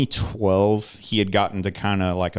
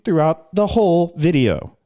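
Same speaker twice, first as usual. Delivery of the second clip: much higher, louder sing-song presenter voice; YouTuber cadence; 10 semitones higher, 5 dB louder than usual. Shown as a demonstration. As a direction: keep the radio cadence going. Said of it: "talk like this".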